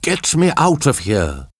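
urgent high plea; trapped survivor's plea. A narrator's reading. Trapped survivor calls for help.

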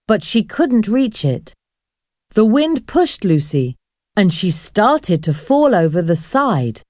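ordinary delivery, nothing marked

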